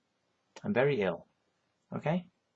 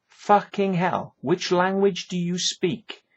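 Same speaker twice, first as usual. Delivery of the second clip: exasperated outburst; 6 semitones higher, 3 dB louder, slower than usual. Real, not acted. Exasperated rant at people.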